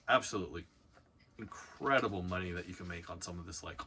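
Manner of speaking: ordinary talk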